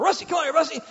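high, loud call across the yard; calling the dog. Retold or imitated. Owner calling the dog Rusty in, loud and high.

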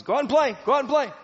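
loud, high shout to go out and play; a mother barking the kids outside; shouted order. Retold or imitated. Mom yelling at crying kids to go play, loud shout.

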